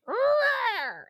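high, strained imitation of a cat growling; mock distressed cat yowl; loud cat growl. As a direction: make the cat's growl, high and pinched. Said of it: "it got loud, like".

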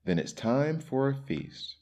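high-pitched, eager exclamation; eager storybook character voice; excited cry. A narrator's reading. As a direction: cry it out like a delighted host. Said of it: "cried Sam".

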